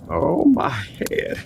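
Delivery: slow, breathless whispered moan; injured sheriff groans in a whisper; pained whisper. Acted. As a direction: wake up dazed and hurting. Injured man comes to.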